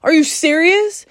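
exasperated what-the-hell, retold; retold angry outburst. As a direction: replay the snap of disbelief, half-acted. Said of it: "I got so mad at my team".

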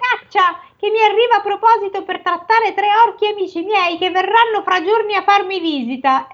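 high ogre voice, gloating. Acted. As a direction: gloat over the catch like an ogre. Ogre voice reading.

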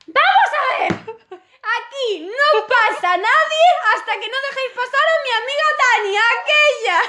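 very high, laughing bossy shout; commanding shout. Retold or imitated. [name] blocking the bus door for Tania, high laughing command. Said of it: "y dije".

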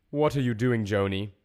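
loud, hurried demand. A narrator's reading. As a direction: demand an answer quickly and forcefully. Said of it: "said the voice more quickly than before".

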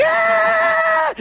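very high thrilled squeal from the back seat; excited kid's squeal. Retold or imitated. Child in back seat spotting the arches, high thrilled squeal. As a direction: squeal like a kid who just spotted a treat. Kid spots golden arches.

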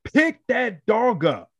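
high, slow yelled order to lift the puppy; shouted command. Retold or imitated. Owner yelling at neighbor to pick up his puppy, high slow shout. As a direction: yell it out slowly, urgent.

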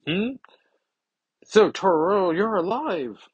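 high, loud astonished exclamation; hammed-up startled delight; acted surprise. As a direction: react in amazement, pitched right up. Acted startled reply to talking gargoyle.

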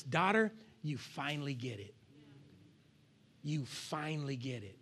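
gentle voice of God. Pretend. God softly telling his daughter she finally gets it. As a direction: answer low and tender, like a pleased father. Voicing God answering the wife.

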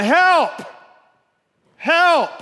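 high, loud mock cry for help; yelling for help. Man hanging from a branch calls out, yelling. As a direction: yell up from the cliff's edge. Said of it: "And he's yelling".

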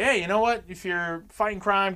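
mock encouragement. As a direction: cheer him on, half mocking. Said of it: "I was like".

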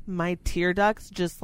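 usual speaking voice

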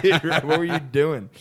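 a loud laughing "what were you doing"; incredulous laughing outburst. Retold or imitated. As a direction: blurt it out cracking up at your friend. Retold disbelief at Red, laughing.

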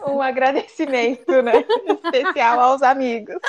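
loud, high, laughing mock thanks; joking laugh. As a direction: shout a teasing thank-you through laughter. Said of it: "Brincadeira essa parte".

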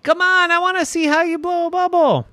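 put-on very high, urging little-kid voice; impatient child. Irene begging to see Mommy blow a bubble, impatient. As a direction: urge it on in a high child's squeal.

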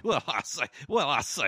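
a hint of a blustery cartoon rooster; Foghorn Leghorn. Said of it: "he does his best foghorn leghorn".

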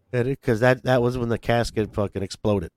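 ordinary talk, nothing marked

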